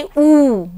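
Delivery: ऊ drawn out long and slow; long vowel. Shown as a demonstration. Teacher to children, showing the long ऊ slowly. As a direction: stretch the ऊ to show a long vowel. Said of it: "लंबा निकलेगा, यानी कि".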